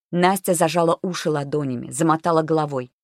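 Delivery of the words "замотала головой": ordinary delivery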